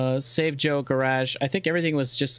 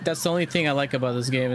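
usual speaking voice

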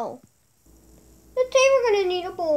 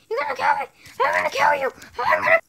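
higher, louder mock threats; pretend fight yell; 6 semitones higher, 4 dB louder, much faster than usual. Playful fight yelling.

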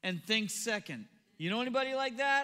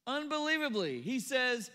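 usual speaking voice

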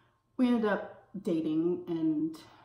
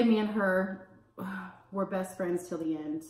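ordinary talk

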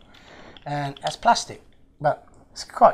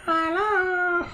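very high, drawn-out squeaky greeting; toy koala voice; 13 semitones higher than usual. Pretend. Koala toy greeting its new owner, high put-on voice. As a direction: pipe up as a little toy.

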